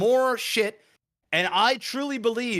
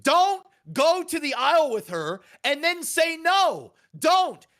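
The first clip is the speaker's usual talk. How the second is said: high, slow yell at the screen; retold yelling; 8 semitones higher, slower than usual. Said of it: "I'm yelling".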